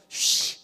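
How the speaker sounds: 쉬 hissed loudly with a long sh; sounding out words. Shown as a demonstration. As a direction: punch out 쉬 as a voiceless sh sound. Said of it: "한번 구분해 볼까요?".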